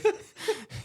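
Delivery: bursting into a high laugh. Real, not acted. Laughing at his remark.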